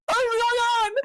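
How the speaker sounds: high-pitched bewildered wail; freaking out. Retold or imitated. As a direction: squeal it as a retold panic reaction. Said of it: "freaking out".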